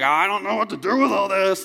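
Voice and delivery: hammed-up exasperated whine; mock frustrated.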